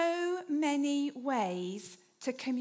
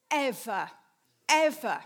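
second "ever" louder than the first; emphatic repetition; 5 dB louder than usual. Acted. Preacher to congregation about Easter news: building emphasis.

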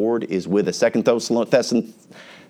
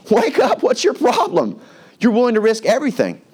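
high-pitched exasperated pleading, acted; hammed-up frustrated wake-up call; 11 semitones higher than usual. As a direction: confront him in a sharply raised pitch. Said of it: "he's frustrated with this situation".

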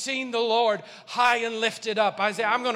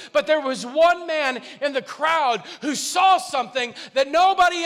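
rising, louder preaching; building sermon; 5 semitones higher, 6 dB louder than usual. Real, not acted. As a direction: build the sermon to a fever pitch.

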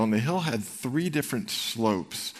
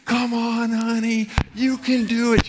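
much higher and louder cheering wife; wife cheering loudly; 12 semitones higher, 6 dB louder than usual. Retold or imitated. Pregnant wife yelling encouragement to her husband, high loud cheer. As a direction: shout him up the hill, bouncing with excitement. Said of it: "out pops my pregnant wife".

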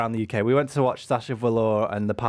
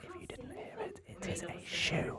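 playful secret whisper; 12 semitones higher, 17 dB quieter than usual. Real, not acted. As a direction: whisper it to the listeners.

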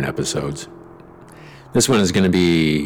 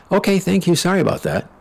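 mock polite; 12 semitones higher than usual. Forced polite manager reply.

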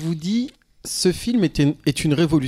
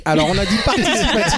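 laughing, pitch up; amused teasing laugh; 7 semitones higher, 4 dB louder than usual. Real, not acted. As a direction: chuckle through the reminder. Host laughing at reluctant guest.

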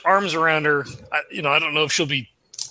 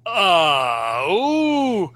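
startled moan. Acted. Player as man snapping up in anguish, startled moan. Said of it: "fucking snaps up".

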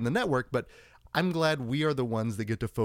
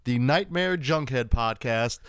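announcer intro; 4 semitones higher than usual. Acted. Host opening the show to listeners, announcer style. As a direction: hype up the podcast opening.

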